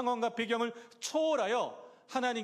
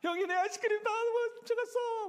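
put-on very high, wailing child voice; hammed-up childish tattling wail; crying little brother; 13 semitones higher than usual. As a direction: bawl to mom and dad like a wronged kid. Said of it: "엄마, 아빠를 향해서 우는 겁니다".

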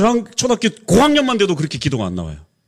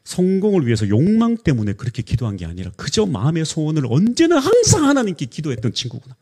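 normal talking voice

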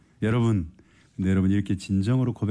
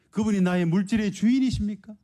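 high, ringing preacher's challenge; fervent preaching; 14 semitones higher than usual. Acted. Preacher challenging the congregation, ringing high cry. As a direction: call it out to the whole church.

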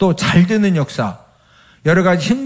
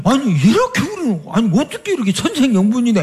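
mock delighted; 7 semitones higher, faster than usual. Mock happy couple.

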